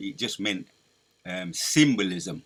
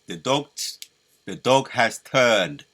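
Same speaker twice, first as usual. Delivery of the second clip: put-on slow, loud, weighty British Churchill accent; Churchill impression; 8 dB louder, slower than usual. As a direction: growl it out like Churchill in wartime. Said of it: "I'm even mocking his, his accent".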